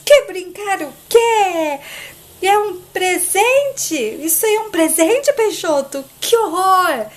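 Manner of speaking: high, drawn-out outraged questions; hammed-up disgusted disbelief; horrified indignation. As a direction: stretch each question in shrieky dismay. Olga appalled by Peixoto's present.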